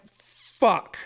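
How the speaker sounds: high, loud stream of curses; cursing over the ruined meal; repeated furious swearing. Retold or imitated. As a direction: spit them out in rage. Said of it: "And I'm like".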